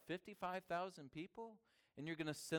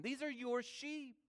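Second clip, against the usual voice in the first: much higher-pitched, insistent handing-over; high, urgent instruction that falls at the end; earnest charge; 10 semitones higher than usual. Acted. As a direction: lift the voice high to press the duty on them. Acts Jesus charging the disciples.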